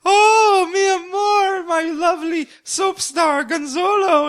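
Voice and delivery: very high, loud swooning crying-out; swooning fans. Retold or imitated. Fans chasing naked soap star, swooning shriek. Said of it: "all the people in the building are like".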